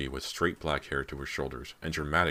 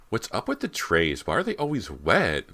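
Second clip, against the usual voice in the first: demanding; 6 semitones higher, 4 dB louder than usual. A narrator's reading. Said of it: "Meg demanded".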